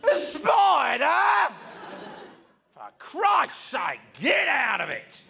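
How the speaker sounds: loud, drawn-out, high bellow; outraged father-in-law. Acted. Father-in-law scorning fear of a spider, slow angry shouting. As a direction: roar it slowly in disbelief. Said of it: "He goes".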